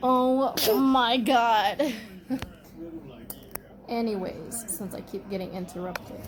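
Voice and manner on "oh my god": drawn-out laughing sigh, louder. Real, not acted. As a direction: laugh it out slowly in disbelief. Laughing after the interruption chaos.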